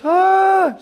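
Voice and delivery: high, loud, held "ah"; startled cry. Retold or imitated. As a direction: cry out as if suddenly lifted. Peter starts to rise in air.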